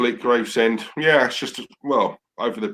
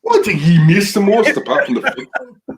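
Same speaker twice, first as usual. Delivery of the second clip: loud, high-pitched Scottish impersonation; put-on Scottish accent; 8 semitones higher, 6 dB louder than usual. Co-host saying it on request, loud mock Scottish accent. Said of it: "Can you say".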